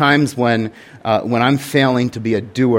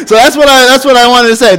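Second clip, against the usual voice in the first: laughing; 11 semitones higher, 7 dB louder, faster than usual. Real, not acted. Laughs at his own confession.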